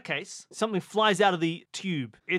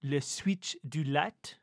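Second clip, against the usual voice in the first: lower, halting stage-French suggestion; mock French accent; 3 semitones lower, 4 dB quieter than usual. Player proposing a light switch search, put-on French accent. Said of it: "speak like a, a Frenchman".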